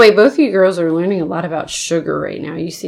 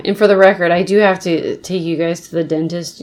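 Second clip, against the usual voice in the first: ordinary talk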